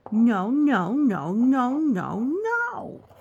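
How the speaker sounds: loud outraged no no no; furious protest. Acted.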